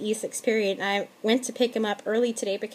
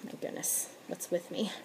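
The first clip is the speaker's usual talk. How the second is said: muttered dismay; 2 semitones lower, 13 dB quieter than usual. Retold or imitated. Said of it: "I just kinda went".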